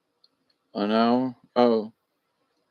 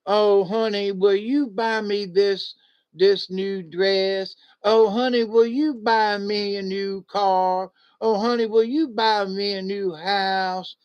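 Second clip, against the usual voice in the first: mock wheedling wife; 5 semitones higher than usual. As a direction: coax a husband for gifts, syrupy. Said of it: "they'll look like a prostitute".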